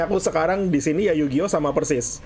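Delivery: usual speaking voice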